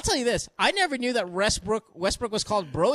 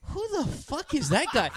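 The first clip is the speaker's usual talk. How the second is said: higher-pitched, mildly mock bewilderment; baffled; 7 semitones higher than usual. Co-host mocking his own cluelessness about Brody, baffled. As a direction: act out total puzzlement with a laugh in it.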